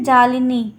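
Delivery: जालिनी with a long first a, जा-लि-नी, falling end; corrected pronunciation. Shown as a demonstration. Teacher correcting herself on the Sanskrit word, clear jaa-li-nee. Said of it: "क्या कहते हैं?".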